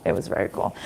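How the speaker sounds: whispered under her breath; half-whispered repeat to herself; whispered aside. Real, not acted. Repeats phrase softly to herself.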